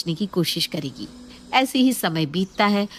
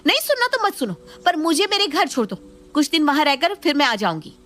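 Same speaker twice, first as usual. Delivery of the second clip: angry; 8 semitones higher, faster than usual. Acted. Wife quarrels with husband.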